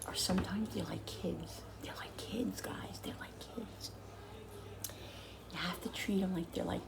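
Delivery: unvoiced whisper aside. Real, not acted. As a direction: whisper it to the viewers.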